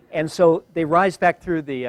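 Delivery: ordinary talk, nothing marked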